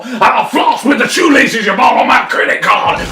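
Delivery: high, loud enraged shouting; roaring wolf. A narrator's reading. Wolf who can't take it anymore threatening the child, roaring. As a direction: bellow the threat to a climax. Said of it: "roared the wolf".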